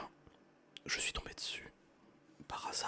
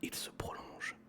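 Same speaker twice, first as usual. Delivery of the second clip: whispered story reading; 11 semitones lower than usual. Acted. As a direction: read the story gently in a whisper. Reads Jules Verne aloud whispering.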